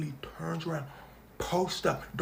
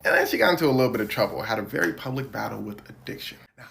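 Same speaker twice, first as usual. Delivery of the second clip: normal talking voice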